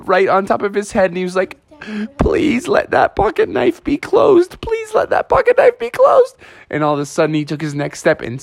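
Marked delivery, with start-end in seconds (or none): 2.2-6.3 high pleading prayer. Acted. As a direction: beg the sky in a tiny high voice. Bubby praying knife is closed.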